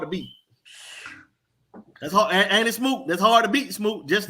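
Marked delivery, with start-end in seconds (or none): none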